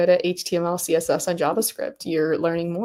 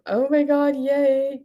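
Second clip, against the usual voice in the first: high, happy squeal of approval; delighted; 6 semitones higher, slower than usual. Real, not acted. Streamer seeing a viewer's GitHub, delighted. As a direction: cheer in a raised pitch.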